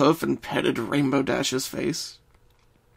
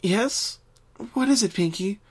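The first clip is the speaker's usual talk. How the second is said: hushed voice; 8 semitones higher than usual. Acted. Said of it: "in a similar hushed voice".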